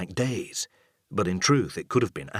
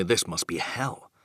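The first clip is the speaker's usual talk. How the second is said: ordinary delivery, nothing marked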